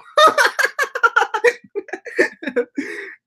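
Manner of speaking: long giggling fit, pitch way up, falling away; high-pitched laugh. Real, not acted. Streamer laughing at his own forgetfulness, high giggling fit. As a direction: crack up helplessly at yourself.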